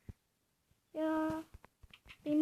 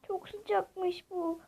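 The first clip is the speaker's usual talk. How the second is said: ordinary talk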